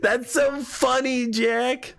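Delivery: high, slow words after cracking up; recovering from laughter. Real, not acted. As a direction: speak through the tail of a laughing fit.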